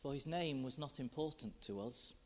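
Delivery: quiet reflective poem reading. Acted. Reads poem aloud.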